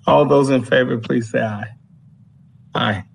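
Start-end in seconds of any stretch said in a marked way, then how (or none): none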